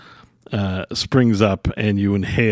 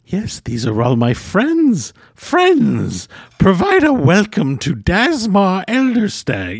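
eccentric hermit voice; 9 semitones higher, slower than usual. Acted. Hermit greeting his mushrooms.

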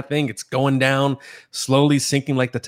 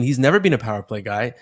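usual speaking voice

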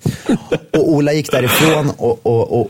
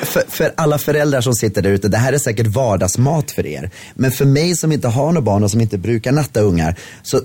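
usual speaking voice

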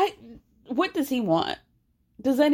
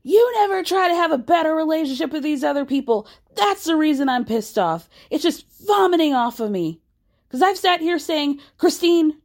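high, loud mock rant; imitating screaming; 7 semitones higher, 3 dB louder than usual. Cody screaming at Christine on the porch, hammed-up loud rant. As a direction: ham up a furious husband yelling. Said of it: "And he starts screaming".

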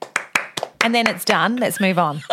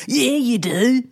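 put-on higher, laughing take-off of an Aussie accent; Aussie Dan; 4 semitones higher, slower than usual. Friend imagines husband as Aussie, mock Australian accent. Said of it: "If Dan were an Aussie".

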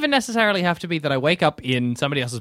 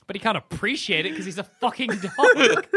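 ordinary delivery

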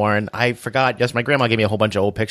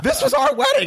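laughing mom. Retold or imitated. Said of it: "She's like".

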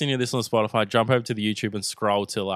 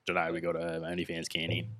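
low, rough Aussie reviewer voice; deep raspy beer reviewer impression; 7 semitones lower, 10 dB quieter than usual. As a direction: drop low like a raspy bloke. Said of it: "do I sound like him".

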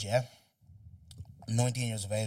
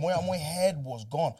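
ordinary delivery

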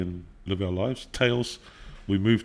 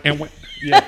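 usual speaking voice

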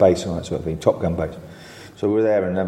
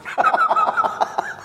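real burst of high-pitched laughter; high squeal of laughter. Real, not acted. Teller laughing at his own punchline, high real squeal. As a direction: let out a high shrieking laugh.

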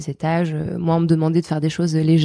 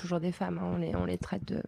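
while sipping a drink; 14 dB quieter than usual. Real, not acted. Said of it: "pardon, je bois un peu".

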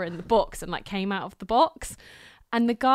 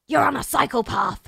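louder and higher, telling off a rude stranger; snapped retort; 5 semitones higher, 6 dB louder than usual. Retold or imitated. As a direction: fire the correction back at him. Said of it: "and I'm like".

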